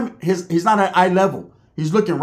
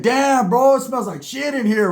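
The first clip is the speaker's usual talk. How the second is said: disgusted visitor; 6 semitones higher, slower than usual. Retold or imitated. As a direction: complain about the stink, pitched up. Visitor complains of the smell.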